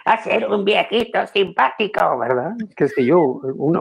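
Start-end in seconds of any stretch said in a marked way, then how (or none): 0.0-2.2 lightly voiced cheerful old man, a bit higher; a hint of a sweet cartoon old man; nice old man voice. Shown as a demonstration. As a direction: play a friendly cartoon grandpa, lightly. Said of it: "una voz más o menos así".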